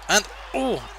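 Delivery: ordinary delivery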